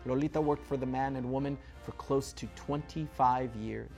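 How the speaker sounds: ordinary delivery, nothing marked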